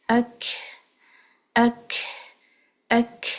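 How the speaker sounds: light ik with tongue down; light kaf. Shown as a demonstration. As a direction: let the kaf drop lightly. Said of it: "اس کو اک کر کے نہیں پڑھنا".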